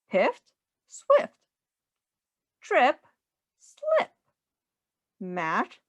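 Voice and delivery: bouncy rhyming storybook voice, swinging up and down; sing-song read-aloud. Acted. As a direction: read it like a playful rhyme for a small child. Reading rhyming picture book aloud.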